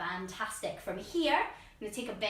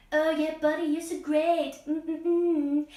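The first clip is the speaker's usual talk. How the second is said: cuddly self-hug talk; 4 semitones higher, 6 dB louder, slower than usual. Pretend. Hugging herself in eagle pose.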